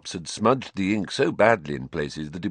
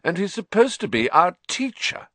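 sneering; 11 semitones higher than usual. A narrator's reading. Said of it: "said Malfoy".

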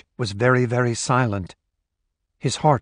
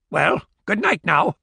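put-on old woman voice; 6 semitones higher than usual. Old woman says goodnight.

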